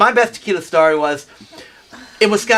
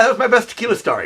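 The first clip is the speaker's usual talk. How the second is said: ordinary delivery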